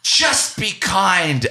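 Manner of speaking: high, slow, syrupy earnest delivery; mock preachy actor. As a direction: parody a sappy stage moral. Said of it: "turned to the audience and said".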